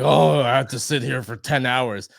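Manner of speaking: lightly whiny imitation of a complaint; mock whining. Mimicking an actor griping about hours in makeup, mock whining. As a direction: whine it like a put-upon actor. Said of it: "like, whining. He's like".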